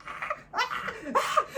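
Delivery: high yelping ah sounds with a laugh; imitated dog yelps. Pug yelps while his nails are clipped, high and laughing. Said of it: "he's yelping".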